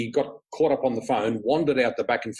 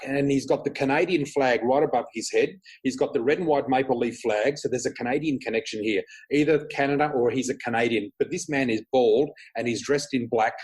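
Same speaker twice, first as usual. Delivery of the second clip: normal talking voice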